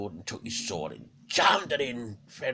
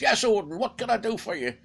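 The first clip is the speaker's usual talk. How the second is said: eager wolf, higher and louder; the giant wolf answering his master brightly; Fenrir the wolf; 12 semitones higher, 9 dB louder than usual. Acted. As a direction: Fenrir answers Odin, high and loud. Fenrir voiced in told myth.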